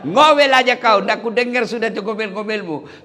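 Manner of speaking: husband mocking his wife, laughing; gloating husband. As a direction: jeer down the well. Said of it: "Dia bilang suaminya".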